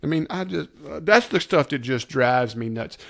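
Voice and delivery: ordinary delivery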